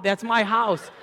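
hammed-up brag, MY punched; mock gloating. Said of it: "I'm like".

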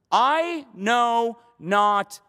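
loud, high, very drawn-out words; slow, ringing disavowal; emphatic denial. Acted. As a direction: stretch each word into a forceful denial. Acting Peter's emphatic denial.

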